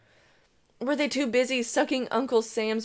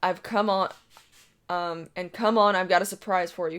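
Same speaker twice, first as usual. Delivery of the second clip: usual speaking voice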